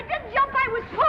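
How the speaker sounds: high, loud and emphatic; indignant protest. Acted. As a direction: protest it hotly.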